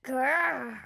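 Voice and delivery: a little growl from the throat; dinosaur growl. Pretend. Small boy playing dinosaur, growling.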